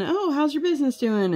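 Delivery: high, chirpy friendly question; high-pitched friendly clerk voice; friendly postal worker. Retold or imitated. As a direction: play the cheerful clerk chatting. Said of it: "they're always asking him".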